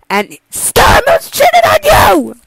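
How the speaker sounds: builds to a high yelled accusation; a mocking heckler's high yell; heckler shout. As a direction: shout the gossip over the vows. Heckler interrupting again.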